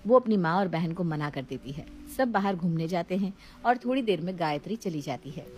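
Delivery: ordinary delivery, nothing marked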